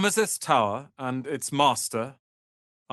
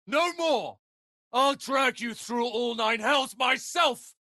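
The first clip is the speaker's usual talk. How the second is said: much higher, slow, screamed threat; furious shout; 12 semitones higher than usual. Acted. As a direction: rage at them, every word hurled.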